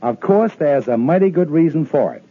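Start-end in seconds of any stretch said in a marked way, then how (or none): none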